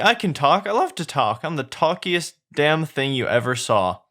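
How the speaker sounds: high-pitched fake donkey voice; pretending to be donkey. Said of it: "Pretends to be donkey".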